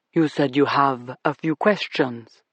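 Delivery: put-on smooth, slow, deep British accent; a suave host in a deep British accent. A narrator's reading. Club owner welcoming the cops, in a deep British accent. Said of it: "deep British accent".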